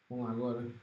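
usual speaking voice

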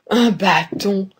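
slow, drawn-out exclamation; exclaimed. A narrator's reading. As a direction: exclaim it slowly, stretching each syllable. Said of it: "s'écria le père". (exclamation lente et étirée)

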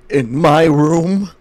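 slow, loud mock indignation; mock outrage. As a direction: play offended, drawn out. Mock outrage at friend's cough.

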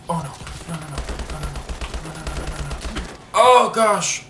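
usual speaking voice

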